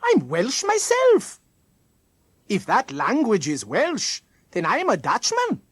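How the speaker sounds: put-on high, lilting Welsh accent, loudly indignant; strong Welsh accent. A narrator's reading. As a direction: protest in a broad Welsh accent. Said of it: "in a strong accent".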